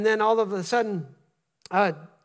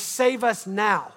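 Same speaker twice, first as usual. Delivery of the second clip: slow pleading cry; much slower than usual. Retold or imitated. Crowd crying out to Jesus.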